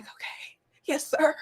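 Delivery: tiny whispered reply; hushed mock submission; meek compliance. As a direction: whisper it obediently. Quoting own meek submission.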